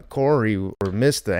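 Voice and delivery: ordinary delivery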